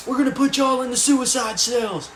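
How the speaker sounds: high, loud mock guard yell; imitated guard threat. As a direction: shout it as a threat, like a fed-up guard.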